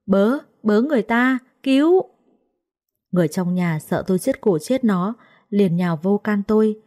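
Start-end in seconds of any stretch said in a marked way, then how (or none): 0.1-2.0 higher-pitched call for help, rising on the last word; narrated cry of alarm, pitched up; choked cry for help. A narrator's reading. Maid being choked calls to the household, crying for help. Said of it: "nó kêu la ú ớ".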